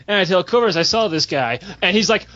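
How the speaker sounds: ordinary talk, nothing marked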